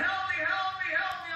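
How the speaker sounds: high, loud desperate plea; panicked, begging for help; crying for help. Retold or imitated. Bob dying in the street, crying out: high panicked plea. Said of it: "Bob begins to cry".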